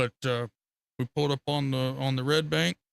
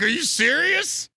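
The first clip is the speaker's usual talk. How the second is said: high, slow, louder amazed question; disbelieving; 13 semitones higher, 5 dB louder, slower than usual. Retold or imitated. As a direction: sound floored by the news, pitched way up. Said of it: "I was like".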